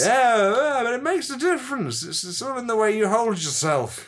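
high, protesting tipsy voice; drunk Gandhi. Pretend. Gandhi defending mirror practice, high tipsy protest. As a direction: insist like a drunk, pitched high. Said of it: "Drunk Gandhi, let's do this".

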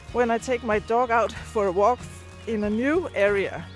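ordinary delivery

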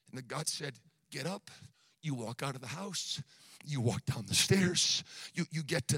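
low, soft recounting of God's orders; hushed sermon storytelling. Real, not acted. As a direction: pull the congregation in with a quiet, deep voice. Drops into hushed retelling.

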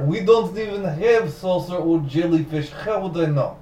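slow mock Russian accent; deadpan comic Russian. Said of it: "in a Russian accent".

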